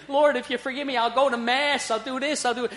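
put-on a frightened kid bargaining with God; desperate bargaining prayer. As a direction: beg God like a scared boy making a deal. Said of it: "and saying".

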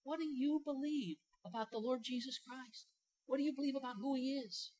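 quiet probing challenge to the congregation; intense stare-down question. Pretend. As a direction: lean in and demand an answer. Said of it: "stare you down and say".

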